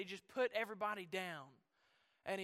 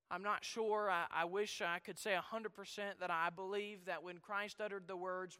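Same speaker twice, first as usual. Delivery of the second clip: ordinary delivery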